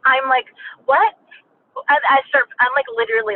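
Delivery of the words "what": high, startled, louder-than-usual question; shocked reaction. Retold or imitated. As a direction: blurt it out in retold disbelief. Reacts to being told time's up.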